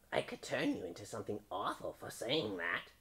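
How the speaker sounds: put-on storybook witch. A narrator's reading. Witch threatening a frog who has offended her, witchy voice. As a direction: play a scheming old witch.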